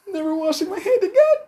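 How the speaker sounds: put-on breathless fan-girl vow, laughing; starstruck squeal. Thrilled by a child's high five.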